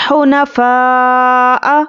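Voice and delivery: slow, melodic tajweed recitation; measured Quranic chant. Shown as a demonstration. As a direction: chant it slowly like a qari. Recites drawn-out word.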